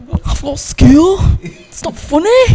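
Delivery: slow, high-pitched Cockney shout; exaggerated Cockney. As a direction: stretch it out in loud, hammed-up Cockney. Exaggerated Cockney comeback after being mimicked.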